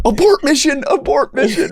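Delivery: high, hammed-up alarm; a high, mock-alarmed abort call; mock panic. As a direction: shout a mock retreat order. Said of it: "You know, you know".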